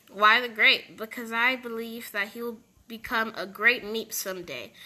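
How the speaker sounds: ordinary talk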